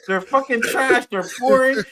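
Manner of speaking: fired-up fan tearing into a movie; angry rant. Real, not acted. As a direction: vent your disgust at the films. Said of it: "Tell me how you really feel".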